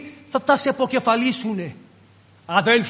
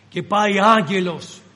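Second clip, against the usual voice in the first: ordinary talk, nothing marked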